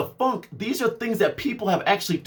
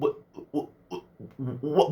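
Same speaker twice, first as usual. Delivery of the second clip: ordinary talk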